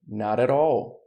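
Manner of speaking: "Not at all" run together with tapped t's, "na-ra-rall"; American flap. Shown as a demonstration. Teacher linking "Not at all" with flapped t's. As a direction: link it smoothly with flapped t's.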